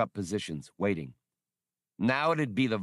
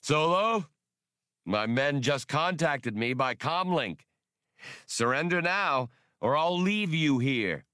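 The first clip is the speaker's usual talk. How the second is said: booming triumph; 6 semitones higher than usual. Acted. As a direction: boom it down gloating. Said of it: "voice boomed down triumphantly".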